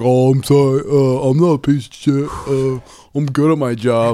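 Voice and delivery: mocking whiny Craig. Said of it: "It was like".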